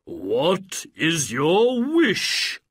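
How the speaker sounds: loud, slow, grand spirit voice; booming genie. Acted. Genie of the lamp greeting his master, slow and booming. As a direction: boom it out like an ancient spirit. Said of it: "said the genie of the lamp".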